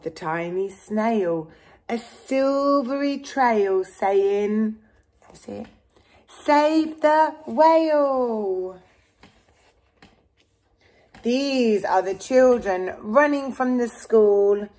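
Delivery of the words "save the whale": "Save the whale" drawn out very slowly, higher; slow proclamation. Acted. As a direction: spell out the message word by word. Reading the snail's trail.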